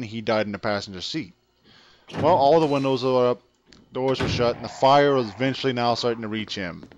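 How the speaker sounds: ordinary talk, nothing marked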